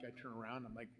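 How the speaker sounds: ordinary talk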